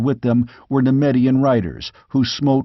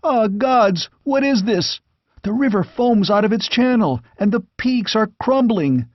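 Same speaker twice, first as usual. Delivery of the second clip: horrified; 9 semitones higher than usual. Acted. Squire sees disaster.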